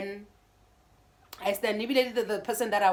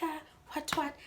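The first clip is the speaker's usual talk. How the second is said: dazed whisper; 8 semitones higher, 6 dB quieter than usual. Retold or imitated. Stunned reply to ex.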